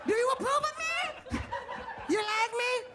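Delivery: frantic high voice. Retold or imitated. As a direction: shriek then plead for approval. Drunk on fire, begging approval.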